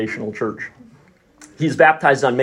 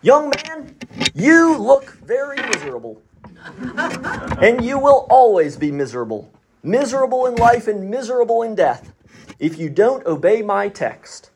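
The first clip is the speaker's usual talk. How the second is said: higher, intense preacher's voice, slowing on the final promise; preacher's warning; 7 semitones higher than usual. Retold or imitated. Preacher singling out young Spurgeon, intense warning sermon voice. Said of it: "he said".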